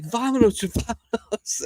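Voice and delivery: lightly voiced Joaquin Phoenix; playful film impression; mimicking film character. Imitating the film character again.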